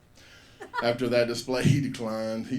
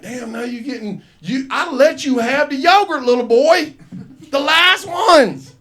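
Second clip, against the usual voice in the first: mock outrage; 11 semitones higher, 12 dB louder than usual. Comic yelling at the boy, high and loud, laughing. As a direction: scold the little boy in hammed-up exasperation. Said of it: "And I was like".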